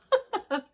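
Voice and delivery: short high laugh, dropping off; quick giggle that falls away; high burst of laughter. Real, not acted. Vlogger to viewers, a short high laugh.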